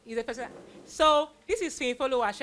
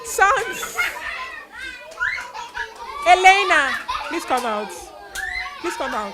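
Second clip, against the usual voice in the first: high, loud call across the room; 8 semitones higher, 5 dB louder than usual. Real, not acted. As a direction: summon them loudly. Calling performers out.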